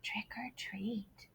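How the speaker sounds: slow, breathy whispered trick or treat; very soft whisper. Acted. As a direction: barely say it. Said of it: "so he very softly said".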